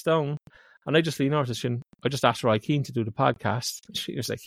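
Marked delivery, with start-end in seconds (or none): none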